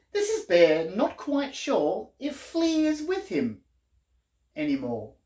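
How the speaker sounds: lively, sing-song picture-book reading; reading to children. A narrator's reading. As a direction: read it like a bedtime book for little ones.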